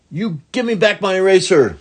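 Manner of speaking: high, loud furious demand; angry child demand. Acted. Ramona yells at Danny, high and angry. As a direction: shout at the thief to give it back. Ramona chasing eraser thief.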